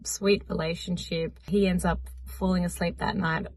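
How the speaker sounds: ordinary delivery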